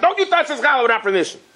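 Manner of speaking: high, fast scolding yell without real volume; mock yelling. Children to orphan boy reaching for challah, mock yelling. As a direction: imitate an indignant telling-off. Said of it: "they started yelling at him".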